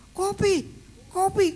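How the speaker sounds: very high, loud yell. Retold or imitated. Patient on the stretcher yelling for coffee, very loud. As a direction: shout it like you're desperate. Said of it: "teriak-teriak".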